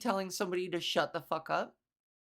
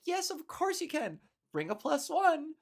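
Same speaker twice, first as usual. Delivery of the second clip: bright, much higher and welcoming; cheery reply; 7 semitones higher than usual. Retold or imitated. Replying to a chat player's joke, high cheerful welcome. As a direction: answer like you're thrilled they asked.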